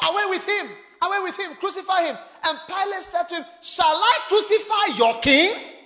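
shouted mob cry and Pilate's reply; crowd shouting. Acted. Crowd crying, Pilate asks.

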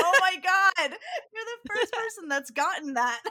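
high, loud, laughing shout of delight. Retold or imitated. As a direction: burst out laughing as the clerk. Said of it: "She goes".

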